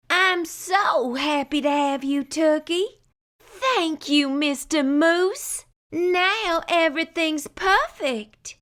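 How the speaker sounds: a beaming hostess; high happy moose wife voice; Missus Moose. A narrator's reading.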